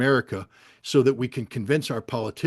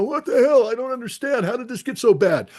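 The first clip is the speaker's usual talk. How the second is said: much higher and louder, a mock panicked scream; a hammed-up panicked yell, much higher and louder; 10 semitones higher, 5 dB louder than usual. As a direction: imitate someone shrieking in terror as the missile comes. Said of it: "panicked, heart in the throat, screaming, go".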